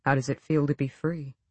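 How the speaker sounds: quiet, hushed question; soft voice. A narrator's reading. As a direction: keep it low and gentle. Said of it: "He still kept his voice soft".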